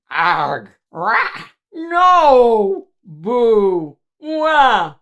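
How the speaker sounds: loud growl, roar and wail; emotion outbursts. Acted. Sore loser cheese venting every emotion, loud cries. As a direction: burst through every feeling in turn. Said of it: "every possible emotion".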